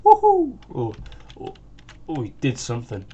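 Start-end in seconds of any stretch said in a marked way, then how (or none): 0.0-0.6 high, loud whoop; imitated cheer. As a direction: whoop it out like someone celebrating. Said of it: "Professor went".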